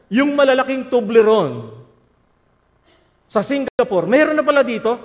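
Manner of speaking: high, loud delighted outburst; excited exclaim. Retold or imitated. Man amazed at big Toblerones in the mall, loud excited cry. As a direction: burst out in happy surprise at the find.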